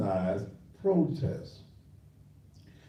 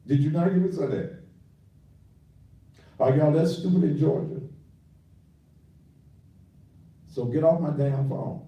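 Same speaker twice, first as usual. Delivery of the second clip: louder, fast, furious repeat; real anger, words tumbling out; angry rebuke; 4 semitones higher, 4 dB louder, faster than usual. Real, not acted. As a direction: hammer the caller with it, fast and loud. Repeats rebuke to caller, angrier.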